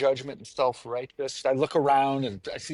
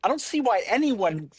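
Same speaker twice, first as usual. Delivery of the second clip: smug vegan; 7 semitones higher than usual. Retold or imitated. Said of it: "so you have a lot of vegans like".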